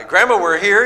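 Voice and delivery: high, loud happy call to grandma. Retold or imitated. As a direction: shout it through the house like a kid arriving. Calling out arriving at grandma's.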